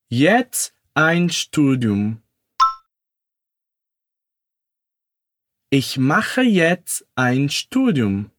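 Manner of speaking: slow, careful German for repeating; syllable by syllable, slowly; slow demo. Shown as a demonstration. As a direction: say it slowly so they can echo. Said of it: "Repete comigo".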